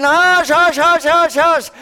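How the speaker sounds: high, loud shushing of the crowd; crowd hushing. Acted. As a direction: hush him like an annoyed crowd. Crowd silencing blind beggar.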